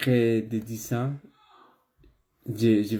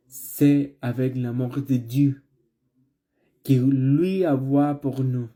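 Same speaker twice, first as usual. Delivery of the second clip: building preaching; 3 semitones higher, 4 dB louder than usual. Acted. Preaching builds toward the close.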